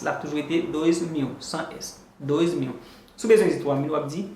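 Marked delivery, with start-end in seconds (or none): 1.4-2.0 whispered aside. Shown as a demonstration. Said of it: "li pa janm pran S".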